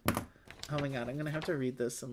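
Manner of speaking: normal talking voice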